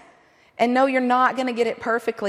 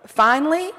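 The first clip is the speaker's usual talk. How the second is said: mock relieved surprise; 5 semitones higher, 4 dB louder than usual. Wife's imagined reaction.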